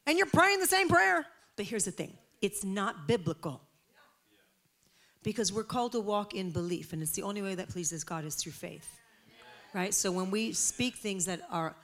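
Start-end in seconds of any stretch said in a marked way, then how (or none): none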